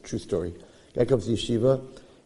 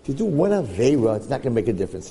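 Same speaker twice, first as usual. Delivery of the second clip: coaxing Satan; 5 semitones lower than usual. Retold or imitated. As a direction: sweet-talk him into one sin. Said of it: "He says".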